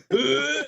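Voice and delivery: high, strained gagging noise; imitated high heaving; mimicked retching. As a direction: gag loudly like someone hit by a stench. Imitates driver gagging in front.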